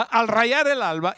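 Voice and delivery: ordinary talk, nothing marked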